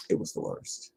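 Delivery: breathy whisper of "It was the worst.". Shown as a demonstration. After a tiring airport run, a worn-out whisper.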